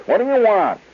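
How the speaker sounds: stern saleswoman confronting a strange customer; brusque clerk. Retold or imitated. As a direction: bark the question at him. Tall clerk snaps at customer.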